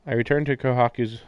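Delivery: normal talking voice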